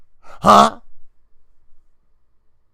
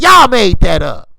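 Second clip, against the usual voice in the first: louder, scolding the British fans; loud outburst; 10 dB louder than usual. Real, not acted. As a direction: throw it right at them, fed up.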